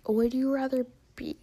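ordinary talk

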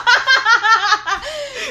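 big high burst of laughter; loud laugh. Real, not acted. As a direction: crack up loudly. Laughs at the saying.

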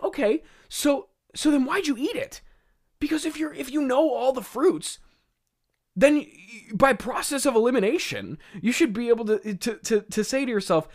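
high, loud, stumbling disbelief; comically worked-up, high-pitched questioning; exasperated incredulity. Real, not acted. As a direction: argue the logic in a high, baffled voice. Mock argument with Adam's logic.

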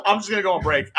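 laughing joke. Retold or imitated. Joking escape, laughing.

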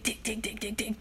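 usual speaking voice

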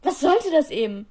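lauter, wütender Vorwurf; wird laut. A narrator's reading. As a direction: fahr sie laut und höher an. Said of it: "wurde er laut". (laut und wütend, höhere Stimme)

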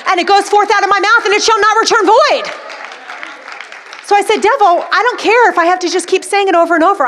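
emphatic, rousing preacher; fired-up preaching. Real, not acted. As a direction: drive it home to the congregation, pitch up. Preaching at full force.

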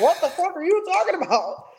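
high-pitched incredulous reaction; retold outraged disbelief. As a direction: act out being floored by the question. Retelling shocked reaction to proposition.

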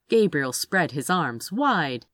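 usual speaking voice

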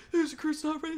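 high, loud mock denial; protesting recruit. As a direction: imitate a recruit insisting to the instructor.

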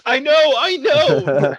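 a hammed-up whiny teen; whiny Ozzy. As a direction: drag it out like a caught kid, loud and high. Ozzy voiced in story.

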